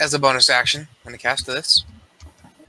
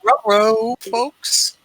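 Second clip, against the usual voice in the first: Scooby-Doo voice; 9 semitones higher, much slower than usual. Acted. Scooby-style exclamation.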